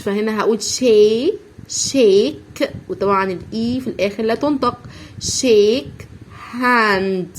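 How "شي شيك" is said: شيك drawn out slowly with a clear sh; slow sh sound. Shown as a demonstration. Teacher to children, drawing out شيك slowly. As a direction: stretch شيك, sh at the start. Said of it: "الحرفين اللي هم اس اتش بننطقهم ش".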